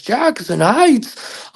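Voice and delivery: high, very slow stretched disbelief; shocked echo. Retold or imitated. Cousin hearing where he is, high drawn-out shock. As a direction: drag out the surprise.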